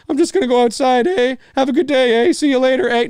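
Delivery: high, loud cartoon eh after every phrase; exaggerated Canadian eh. Showing how US cartoons overuse eh, exaggerated accent. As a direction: punch eh like punctuation, hammed up. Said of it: "Nobody sounds like that here".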